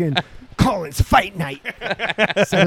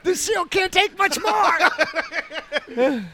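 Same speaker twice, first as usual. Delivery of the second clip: laughing mock alarm; 8 semitones higher, much slower than usual. Mock sci-fi urgency, laughing.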